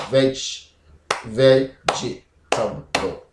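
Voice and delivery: "vegetable" slowly split, veg... ve-ji-ta-bol; syllable by syllable. Shown as a demonstration. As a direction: break "vegetable" into separate beats. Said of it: "clap each time you hear".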